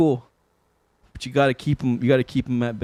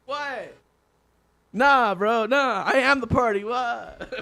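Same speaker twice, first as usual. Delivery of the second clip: mock innocent denial; 7 semitones higher, much slower than usual. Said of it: "I'm like".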